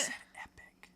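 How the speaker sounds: hushed, playful "Said epic."; teasing whisper. Acted. Co-host teases the reviewer in a whisper. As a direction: whisper the jab under your breath.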